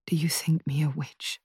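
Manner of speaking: hushed, tense whisper; a cold whispered challenge. Acted. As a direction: whisper the accusation. Daughter quietly confronting mother.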